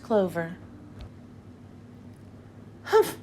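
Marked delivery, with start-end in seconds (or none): 2.8-3.2 a high, sour little humph; sniffy disdainful humph. A narrator's reading. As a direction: scoff it out like a sulky kangaroo. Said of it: "humphed a voice".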